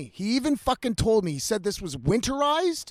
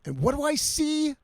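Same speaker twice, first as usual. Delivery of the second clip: usual speaking voice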